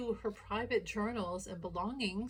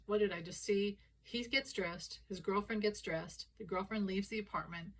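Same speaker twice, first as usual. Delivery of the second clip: normal talking voice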